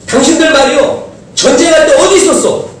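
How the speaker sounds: high, loud accusing outburst; a war hero snapping at latecomers; angry retort. Retold or imitated. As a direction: throw the question back at them, furious. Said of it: "이 말 한마디 하면 전쟁이 일어날 판".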